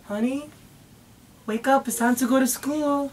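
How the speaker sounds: put-on soft, high mommy voice calling a child awake; gentle wake-up call. Shown as a demonstration. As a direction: coax the baby awake like a morning routine. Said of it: "I'm gonna wake you up".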